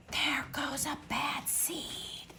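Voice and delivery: hushed gossiping whisper behind his back; mumbled whisper. Acted. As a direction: breathe it out like a sneaky rumor. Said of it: "they mumble".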